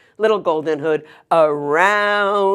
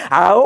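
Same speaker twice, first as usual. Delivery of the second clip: a short loud wolf's howl; loud opening howl; 7 dB louder than usual. Acted. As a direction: howl like a wolf before speaking. Wolf howl opening his speech.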